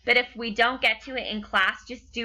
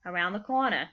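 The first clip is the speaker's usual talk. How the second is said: put-on Boston accent, "car" as "cah" and "corner" as "cornah", no r's; 3 semitones lower than usual. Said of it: "they say".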